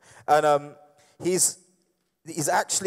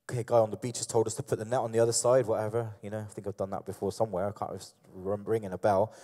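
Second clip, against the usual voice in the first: low, soft, offhand thinking aloud; puzzled musing; 6 semitones lower, 5 dB quieter than usual. Acted. Peter on the boat wondering about the man on the beach, puzzled musing. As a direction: muse to yourself, trying to place a vague memory. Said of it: "do you think he was on there going".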